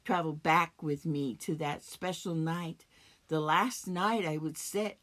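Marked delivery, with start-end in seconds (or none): none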